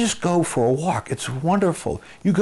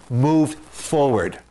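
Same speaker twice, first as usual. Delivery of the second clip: slow and deliberate; much slower than usual. Shown as a demonstration. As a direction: draw out move forward very slowly. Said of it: "first thing you have to get the horse to do".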